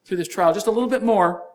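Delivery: high, loud gentle urging; a lifted, pleading encouragement; coaxing voice of God. Retold or imitated. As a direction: coax them through the last stretch. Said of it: "God's just saying".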